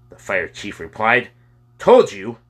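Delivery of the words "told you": loud, higher-pitched interjection; blurted out. A narrator's reading. Boy Kyle cutting in on the men, blurting. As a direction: burst in before anyone can stop you. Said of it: "Kyle blurted out".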